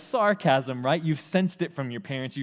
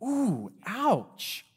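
higher pained "Ooh, ouch"; mock wince; 7 semitones higher than usual. Listener stung by the sarcasm, higher mock wince. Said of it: "It's like".

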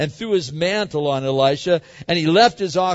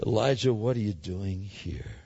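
much softer and lower, gentle calling; quiet voice of God; 7 semitones lower, 7 dB quieter than usual. Pretend. God gently calling Elijah out of the cave, softly and low. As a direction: call him softly, like a still small voice. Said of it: "He says".

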